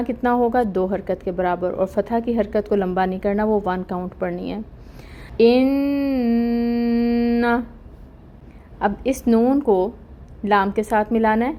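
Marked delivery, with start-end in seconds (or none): 5.4-7.5 ان said with a held nasal hum on the doubled noon, about two counts; nasal ghunna. Shown as a demonstration. Teacher demonstrating ghunna to students, held nasal hum. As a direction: hold the noon of ان in the nose. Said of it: "غنہ ہوگا".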